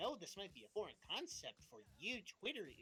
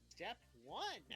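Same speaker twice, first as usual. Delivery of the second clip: ordinary talk, nothing marked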